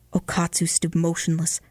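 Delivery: plain reading voice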